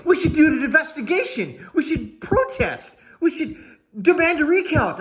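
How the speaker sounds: high mock indignation; mock protester. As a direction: parody earnest protest demands. Mocking protesters' demands.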